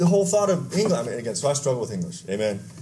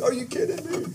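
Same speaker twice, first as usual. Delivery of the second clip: much higher, softer stunned question; disbelief; 13 semitones higher, 5 dB quieter than usual. Retold or imitated. Boy told it's the Rockies, high awed disbelief. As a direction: breathe it out in awe. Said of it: "I'm like".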